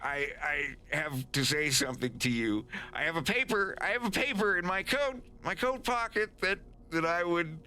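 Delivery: high-pitched, desperate pleading; frantic high plea to a captor; begging. Acted. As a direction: beg nervously to be heard. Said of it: "he's just, like, begging".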